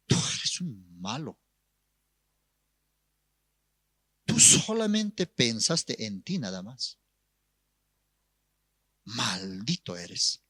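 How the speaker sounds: low, slow, grave condemnation; merciless rebuke. Retold or imitated. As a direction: pastor passing stern judgement, pausing heavily. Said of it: "Le exhortó el pastor sin misericordia".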